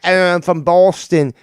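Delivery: put-on loud, drawn-out Boston accent; mock Boston accent. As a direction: honk it out as a caricature Bostonian. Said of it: "that accent deserves the entire state".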